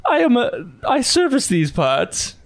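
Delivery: suave butler. Acted. Butler Silas greets the orcs, overly courteous. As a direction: offer service with a flourish.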